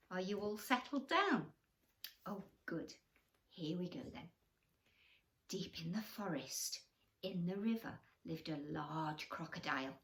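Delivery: ordinary delivery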